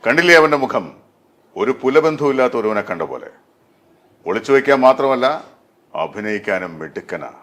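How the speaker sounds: loud, theatrical film villain dialogue; Riyas Khan. Shown as a demonstration. Dubbing artist performs a hit film line on request, as a screen character. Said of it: "ഏതെങ്കിലും ഒരു dialogue ഞങ്ങൾക്ക് വേണ്ടി പറയാമോ".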